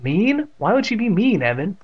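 high-pitched puzzled question; taken-aback mother; surprised. A narrator's reading. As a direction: ask in surprise, pitched right up. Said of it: "caught his mother by surprise".

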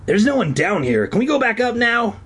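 pleading. A narrator's reading. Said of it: "he pleaded".

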